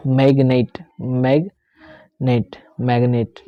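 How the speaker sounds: मैग्नेट drawn out very slowly, vowel held long; slow repeat-after-me drill; pronunciation demo. Shown as a demonstration. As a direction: model मैग्नेट slowly for the class. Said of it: "जैसे मैं बोल रहा हूँ वैसे आपको भी बोलना".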